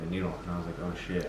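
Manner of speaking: quiet numb-mouth speech; slurring through dental numbness. Real, not acted. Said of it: "half of your mouth is moving".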